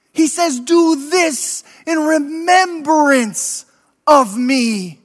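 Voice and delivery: high, slow, preached mock shout; shouting from the stake. Condemned man to the priest, a high slow rebuke. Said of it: "he shouted out from the stake".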